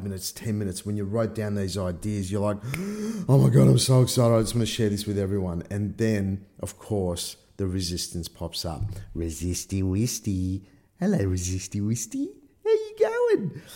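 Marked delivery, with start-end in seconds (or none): 2.6-3.2 high, quick gasp of delight; excited gasp. Retold or imitated. Retold thrill at new idea. 3.3-5.6 fast gush, starting loud then easing; retold excitement. Retold excitement at new idea. 9.1-13.6 playful pet-name voice. Pretend. Playfully greets personified resistance.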